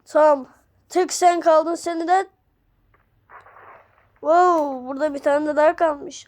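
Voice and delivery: normal talking voice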